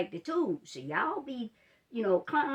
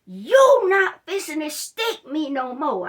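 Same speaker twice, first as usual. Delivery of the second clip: much louder, slower shout, every word hammered; angry shout; 7 semitones higher, 12 dB louder than usual. Retold or imitated. Patient shouting at the nurse to stop the needles, furious slow shout. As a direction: blow up at her, no more sticking. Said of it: "I'm about to lose it in that room".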